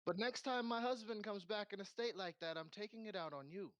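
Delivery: high-pitched scolding threat; stern wifely warning. Acted. Innkeeper warns her husband's companion, stern and high-pitched. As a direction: lay down the law in a high voice.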